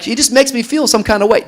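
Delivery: high, loud smitten imitation; exaggerated lovesick excuse, loud and high; mock lovestruck. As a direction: gush it in a hammed-up high voice.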